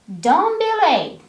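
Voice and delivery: high, loud and rude. Shown as a demonstration. Teacher demos a rude high-pitched order. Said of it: "If you say it with a high tone".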